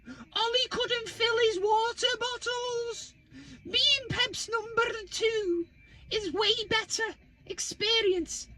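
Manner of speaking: high, slow mock pundit voice; mocking quote. Mocking a pundit's old tweet, high slow sneering voice. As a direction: read the tweet like a smug know-all. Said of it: "Brace yourself".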